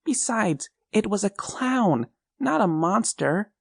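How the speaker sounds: put-on high child's voice, frightened and pleading; a small boy telling his mom about the clown; scared little boy. A narrator's reading.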